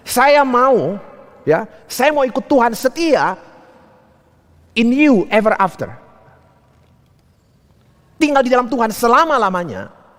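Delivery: high, fervent declaration of faith; impassioned preaching. Retold or imitated. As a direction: proclaim devotion with a preacher's force.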